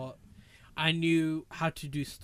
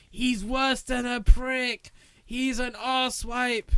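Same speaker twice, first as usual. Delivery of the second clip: high, loud, drawn-out mock yell; brother shouting; 10 semitones higher, 8 dB louder, much slower than usual. Imagining his brother hearing the show, shouting insults. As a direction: holler each word slowly, pitched right up. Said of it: "imagine my brother, if he listens to it, he'd be like".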